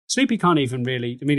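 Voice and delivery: usual speaking voice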